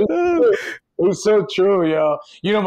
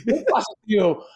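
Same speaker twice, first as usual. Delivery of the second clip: animated, higher Dominican accent; broken Dominican Spanish; 4 semitones higher, 3 dB louder than usual. Shown as a demonstration. Joking about the bachelor party plans, animated Dominican accent. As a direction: improvise livelier in a Dominican accent, pitch lifted. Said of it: "we can do my broken Dominican Spanish".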